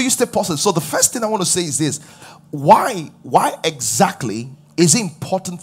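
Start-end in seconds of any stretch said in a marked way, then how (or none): none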